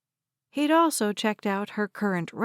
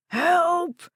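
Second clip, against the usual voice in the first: high, loud panicked cry; terrified shout; 9 semitones higher, 4 dB louder than usual. Acted. Old man crying out for help from inside. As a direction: scream for help in terror. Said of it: "Red's shout sounded terrified".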